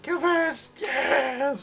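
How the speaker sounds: very high, loud excited cheer; hammed-up high crowd shout; cheering fan. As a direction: squeal the name like a fan. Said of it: "there's definitely people now cheering".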